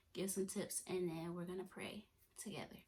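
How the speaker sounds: normal talking voice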